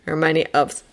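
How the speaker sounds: ordinary delivery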